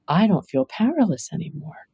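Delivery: usual speaking voice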